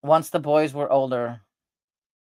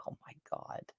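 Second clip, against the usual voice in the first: breathless unvoiced whisper of disbelief; a hushed, stunned oh my God; 23 dB quieter than usual. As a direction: whisper it in shock. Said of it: "but I'm like".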